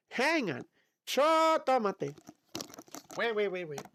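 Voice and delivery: ordinary talk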